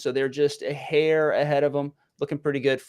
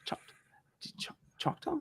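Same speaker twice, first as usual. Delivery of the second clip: halting, near-voiceless stammer; stunned whisper; 13 semitones higher, 16 dB quieter than usual. Retold or imitated. Dad acting out his reaction to his son, stunned whisper. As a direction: whisper it in disbelief, breaking off and restarting. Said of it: "And I was like".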